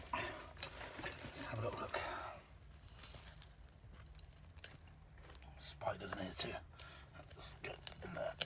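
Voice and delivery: slow hushed whisper. Real, not acted. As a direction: breathe it like sneaking up. Peering into spidery hole.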